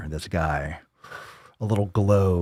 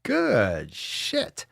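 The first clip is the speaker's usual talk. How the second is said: ordinary talk, nothing marked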